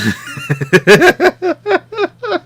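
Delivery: high, loud burst of laughter. Real, not acted. Left alone with one co-host, real high laugh. Laughs after friend drops out.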